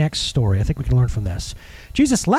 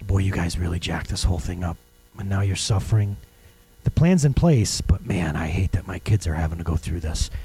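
sad father; 11 semitones lower, 4 dB quieter than usual. Retold or imitated.

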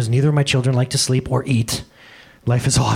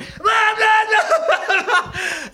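very high wordless wail imitating a child singing; mock child singing. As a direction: belt out a high nonsense song like a kid. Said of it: "singing a song at the top of your voice".

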